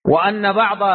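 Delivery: usual speaking voice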